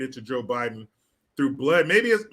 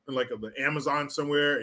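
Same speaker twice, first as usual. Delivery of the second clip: ordinary talk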